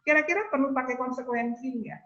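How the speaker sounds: usual speaking voice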